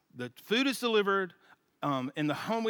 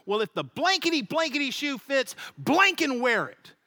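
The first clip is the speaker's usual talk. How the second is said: retold paperboy retort; 6 semitones higher than usual. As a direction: throw it back at the neighbor, imitating the paperboy. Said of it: "turns on my next door neighbor and goes".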